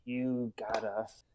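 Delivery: soft, slow, sheepish admission; quiet deflated concession; sheepish defeat. Retold or imitated. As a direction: mumble it like a caught cop. Defeated cops, retold.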